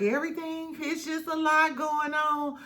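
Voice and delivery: dismissive mock quote. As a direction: brush it off like a tired castmate. Quoting a cast member's excuse.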